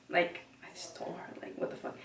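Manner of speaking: voiceless aside; hushed exasperated aside; whispered frustration. Real, not acted. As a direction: whisper the swear to camera.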